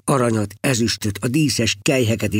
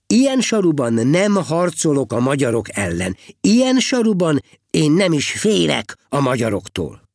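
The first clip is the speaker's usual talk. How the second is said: higher-pitched, emphatic refusal; defiant protest; 5 semitones higher than usual. Acted. Heribald answering the abbot's rebuke, defiant protest. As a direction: refuse stubbornly, pitch lifted.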